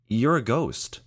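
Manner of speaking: high, startled blurt; Michael blurting at the ghost; surprised blurt. Acted. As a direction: speak before you think.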